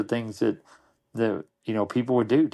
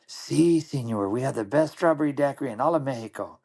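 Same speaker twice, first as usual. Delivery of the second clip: higher mock Mexican waiter voice, proudly selling; Mexican waiter accent; 4 semitones higher than usual. Waiter answers the order in a playful Mexican accent. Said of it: "And the guy goes".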